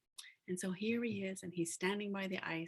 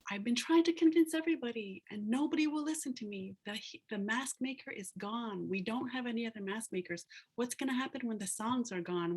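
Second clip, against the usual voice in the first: higher, louder retold plea; urgent pleading; 4 semitones higher, 4 dB louder than usual. Youth begging the old healer to listen, urgent pleading. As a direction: act out a youth desperate to be heard.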